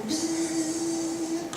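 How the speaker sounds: soft imitated buzzing of a fly. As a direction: buzz quietly like a fly. Fly lands on his meatballs.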